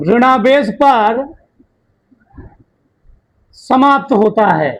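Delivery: slow, deliberate dictation with long pauses; teacher dictating a point slowly to the class. Shown as a demonstration. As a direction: spell it out slowly for students to copy. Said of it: "मैं आराम आराम से बोल रहा हूं".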